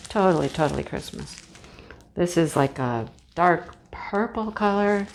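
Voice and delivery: ordinary talk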